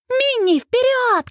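high, excited cheering cry; high-pitched rallying call; cheering shout. A narrator's reading. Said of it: "кричит Сьюз".

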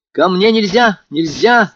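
high, loud, frightened refusal; terrified cry. A narrator's reading. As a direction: cry it out in panic. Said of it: "вскричала она ещё в сильнейшем испуге". (испуганный громкий вскрик)

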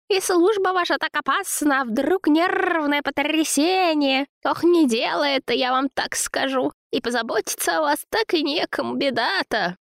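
very high, cooing flattery; babbling, fussing woman. A narrator's reading. Zina soothes angry Vova, high fawning babble. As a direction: coo and fuss to soothe him. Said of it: "лепетала она".

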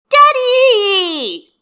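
put-on high, loud little girl's shout; a little girl hollering for her daddy; child yelling. A narrator's reading. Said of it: "she yells".

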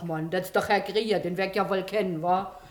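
ordinary talk

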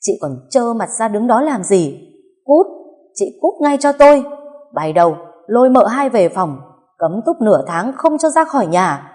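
high, loud, furious orders; Bà Hà, anguished yelling. A narrator's reading. Mother-in-law ordering her daughter-in-law out of sight, anguished yell. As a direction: yell her out, pitch soaring. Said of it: "gào lên".

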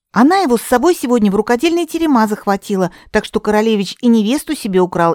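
high, fast persuasive chatter; eager persuading girl. Acted. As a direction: talk the queen round quickly. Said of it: "напомнила Катерина".

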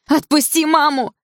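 high desperate yell; screaming. A narrator's reading. Daughter tries to free her mother, screaming. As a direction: shout it again at full pitch. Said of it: "закричала я".

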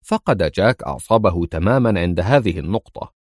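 plain reading voice

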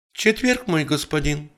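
high-pitched maid's reply; quick servant's answer. A narrator's reading. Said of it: "быстро ответила служанка".

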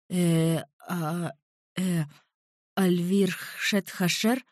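hesitant, stammering. A narrator's reading. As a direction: babble nervously. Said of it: "пролепетал он".

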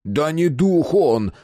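high, drawn-out exasperated howl; slow, high cry of frustration; desperate wail. A narrator's reading. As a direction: wail it in despair. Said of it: "взвыл в отчаянии Мэтт".

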